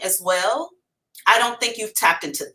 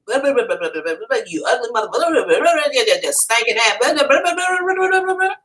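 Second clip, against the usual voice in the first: going in on someone; 3 semitones higher, faster than usual. Acted. Woman on the porch going in on somebody, mock rant. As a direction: rattle off a pretend telling-off, pitch rising toward the end. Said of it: "I'm going in on you".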